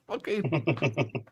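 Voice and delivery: ordinary talk, nothing marked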